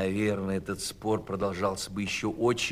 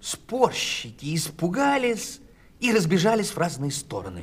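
frightened narration; 9 semitones higher, 5 dB louder than usual. A narrator's reading. Thunder scares them off.

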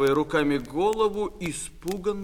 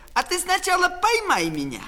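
high, called-out taunt; high-pitched teasing call from up a tree; taunting dare; 12 semitones higher, 4 dB louder than usual. Acted. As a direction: dare your sister to chase you. Boy taunts while climbing away.

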